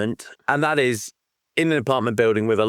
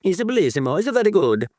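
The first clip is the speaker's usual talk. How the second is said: hammed-up Italian accent, higher; 7 semitones higher, faster than usual. Host answering cohost, hammed-up Italian accent. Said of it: "good Italian accent".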